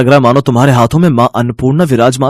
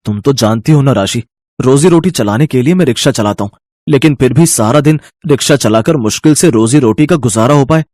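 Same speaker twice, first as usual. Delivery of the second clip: hesitant; 7 semitones lower, 6 dB louder than usual. Acted. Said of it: "अमन हिचकिचाते हुए कहता है". (हल्की हिचकिचाहट के साथ)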